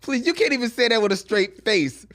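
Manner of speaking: loud, high, amused protest; scoffing disbelief. Real, not acted. Scoffing at the co-host's song pick, loud incredulous protest. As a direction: dismiss it loudly with a stretched please.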